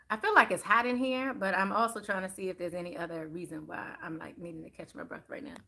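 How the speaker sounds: ordinary delivery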